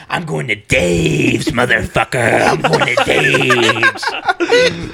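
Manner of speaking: hammed-up tantrum with the last word stretched; imitated irate customer. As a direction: start measured, then screech the store name. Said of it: "That's how he said it".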